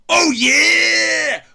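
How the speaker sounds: loud wrestler-style roar; hammed-up Oh yeah bellow; Kool-Aid Man bursting in. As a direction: crash in shouting the catchphrase. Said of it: "both of them come in and go".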